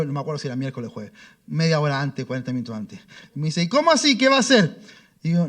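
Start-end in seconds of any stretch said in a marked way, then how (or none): 3.6-4.8 surprised boss. Retold or imitated. Abogado sorprendido pregunta.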